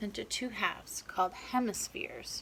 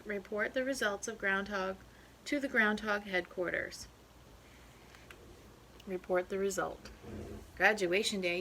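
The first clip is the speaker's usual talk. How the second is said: plain reading voice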